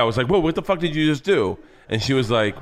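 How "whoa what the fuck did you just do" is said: higher, startled retold protest; mildly alarmed, voice pitched up. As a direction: act out a baffled objection. Retold startled protest during sex.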